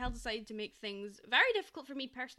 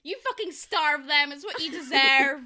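mock cheering; 11 dB louder than usual. Cheering the girls on while the guys starve, in a loud mock yell. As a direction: yell it like a rowdy fan egging them on. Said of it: "I'm like".

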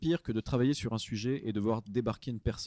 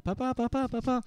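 put-on high-pitched whiny kid calling Papa; lightly put-on child voice, much higher; pestering child; 13 semitones higher than usual. Child interrupting a busy parent, pestering. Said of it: "l'enfant qui vient dire".

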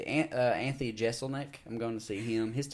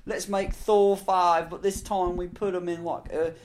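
slow, louder, higher Kiwi-ish impression; Taika Waititi; 7 semitones higher, 5 dB louder, slower than usual. Podcast host imitating a film director's Kiwi accent. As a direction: drawl it like a New Zealand director pitching. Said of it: "now you sound like Taika Waititi".

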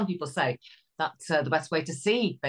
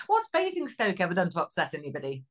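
ordinary delivery

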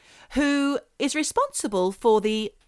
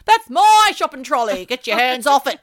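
loud, higher, play-acted row; hammed-up possessive shout; mock brawl shout; 4 semitones higher, 8 dB louder than usual. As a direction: yell it like a pretend fight over your things. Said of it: "No, that's a brawl".

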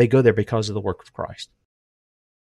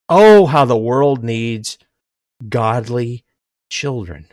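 slow lament; slower than usual. Real, not acted. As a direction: let the sorrow trail off.

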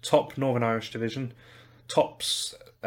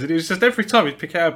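ordinary delivery

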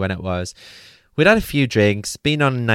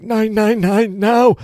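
desperate plea; 11 semitones higher, 4 dB louder, slower than usual. Retold or imitated. Begging lad to phone ambulance.

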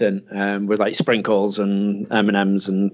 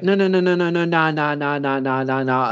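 put-on a little kid saying no over and over; toddler refusing; 4 semitones higher than usual. Son rejecting the scoop just put in, whiny refusal.

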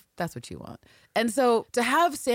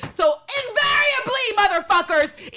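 much higher, louder and slower shout; emphatic shout; 7 dB louder than usual. Real, not acted. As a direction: call out to the listeners with force. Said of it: "shouting at the audience".

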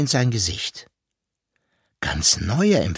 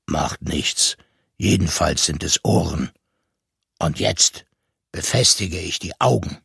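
low, unhurried voice of the deep-voiced maker; deep serious voice; 6 semitones lower than usual. A narrator's reading. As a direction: keep it low and matter-of-fact. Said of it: "Die Stimme war tief und ernst".

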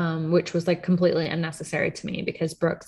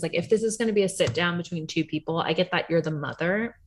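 usual speaking voice

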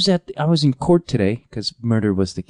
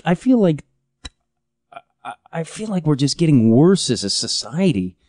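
ordinary talk

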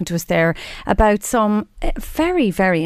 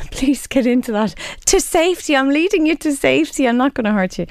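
higher, soothing coax to an animal; 6 semitones higher than usual. Pretend. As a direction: gently coax a scared bird. Coaxing the sick pigeon to safety.

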